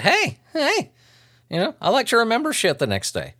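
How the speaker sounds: high voice, as if suddenly enlightened; mock realization. Speaker voicing his younger self's realization, mock enlightened. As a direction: chirp it like a sudden epiphany.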